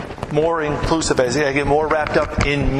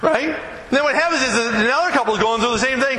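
ordinary delivery

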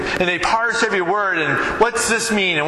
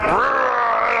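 higher-pitched rolled "rrr" of exasperation; frustrated growl; 6 semitones higher than usual. Retold or imitated. Man stuck under the car with a broken bolt, frustrated growl. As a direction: growl as if fed up with a stubborn job. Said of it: "I'm just getting so frustrated".

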